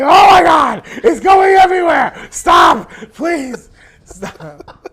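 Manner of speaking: very loud, high-pitched panicked yelling; imitated friend in comic desperation; mock panicked shouting. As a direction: shriek for it to stop, hamming it up. Imitating friend's panic while pissing.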